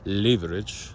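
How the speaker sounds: Leverage said LEE-ver-idge with long ee and a rise; British leverage. Shown as a demonstration. Vlogger demonstrates British word, LEE-ver-idge. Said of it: "the British way of pronouncing it".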